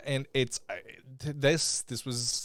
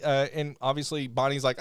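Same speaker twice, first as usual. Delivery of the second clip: ordinary talk, nothing marked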